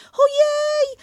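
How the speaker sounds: shocked tiny yelp; Piglet crying out. Acted. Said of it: "krzyczał prosiaczek".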